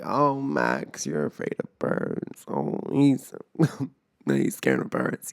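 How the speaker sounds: teasing singsong, breathy and low; mock him like a smug friend; a hint of a teasing friend. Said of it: "all my friends always love to make fun of me".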